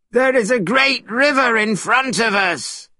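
high, urgent call to the group; shouted. A narrator's reading. Sandy alerting the pilgrims to a river, shouted. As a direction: call out a warning from ahead. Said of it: "Soon Sandy shouted".

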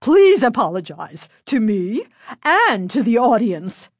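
loud, insistent teacher; demanding. A narrator's reading. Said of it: "Missus Berman demanded".

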